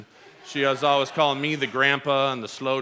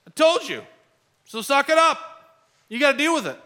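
higher, louder scolding God voice; scolding told-you-so; 12 semitones higher, 5 dB louder than usual. Retold or imitated. Imagined God scolding Israel, high and loud told-you-so. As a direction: rub their failure in. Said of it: "A perfect time for God to say".

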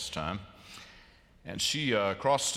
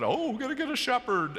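excited tourists; 3 dB louder than usual. Retold or imitated. Tourists excited seeing shepherd.

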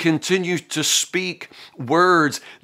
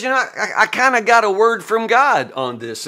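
higher, self-assured claim; mock smug Eliphaz; 6 semitones higher than usual. Eliphaz bragging to Job about his revelation, mock smug. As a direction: boast of a word from God. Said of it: "he says".

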